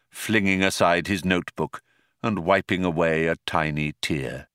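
plain reading voice

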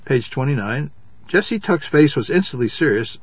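plain reading voice

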